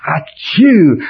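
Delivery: loud mock sneeze; fake sneeze. Acted sneeze mid-prayer.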